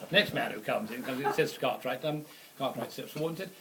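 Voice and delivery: usual speaking voice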